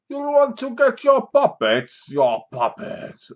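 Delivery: slow, high, strained attempt at a German villain; hammed-up German officer drawling the words out; a failed mock German accent, slow and high; bad German accent. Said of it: "it was like this".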